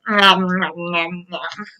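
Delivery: low munching, fading to soft; munch it, dying away; chewing sounds. Pretend. Dragons chewing, reader voices eating.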